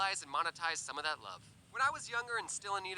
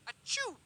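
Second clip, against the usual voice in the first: fake sneeze; 14 semitones higher than usual.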